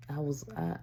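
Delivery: ordinary delivery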